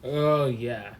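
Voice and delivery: usual speaking voice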